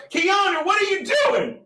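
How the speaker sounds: high-pitched questioning holler; calling out. Real, not acted. Host calling to Keanu about what she's doing, high holler.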